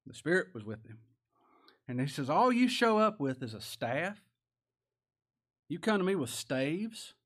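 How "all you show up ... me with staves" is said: high, slow incredulous questioning; acted giant's affronted challenge; appalled Goliath. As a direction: stretch it out in scornful disbelief. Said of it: "just appalled at this".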